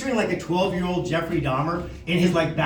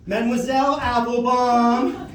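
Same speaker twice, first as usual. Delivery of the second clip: slow, higher, drawn-out singsong; mock contrition; 5 semitones higher than usual. Corrected pupil addressing French teacher, exaggeratedly obliging. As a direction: stretch out the French title like a chastened pupil.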